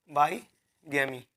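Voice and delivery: बाईगैमी split by a pause, as if writing it; slow dictation. Shown as a demonstration. Teacher writing the next word for students, slow dictation of बाईगैमी.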